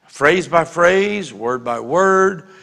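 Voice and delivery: phrase by phrase, slowly; measured, each phrase set apart; slow down and weigh each word; slow, deliberate. Shown as a demonstration. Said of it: "pray them slowly".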